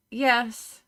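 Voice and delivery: ordinary delivery, nothing marked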